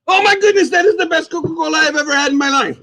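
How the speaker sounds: high-pitched burst of mock amazement; hammed-up rave. Host raving over a first sip of Coke, hammed-up excitement. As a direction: gush wildly, voice held up high.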